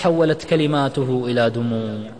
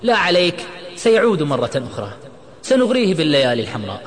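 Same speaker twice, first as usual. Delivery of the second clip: higher, forceful angry retort; angry shout; 5 semitones higher, 4 dB louder than usual. A narrator's reading. Imad vows to lure friend back, angry. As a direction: insist it with heated force. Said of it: "صرخ عماد غاضبا".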